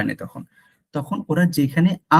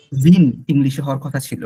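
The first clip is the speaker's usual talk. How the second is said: ordinary talk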